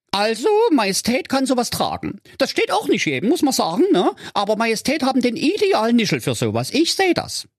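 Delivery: high, fawning courtier voice; butter up the king in a high lisp-free simper; fawning servant. Acted. Servant flattering the king about his pizza hat, high and fawning.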